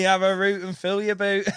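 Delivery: half-laughing car boot sales cry; mock trader's shout. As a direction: imitate a hawker's patter with a laugh in it. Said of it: "you know, shouting and that".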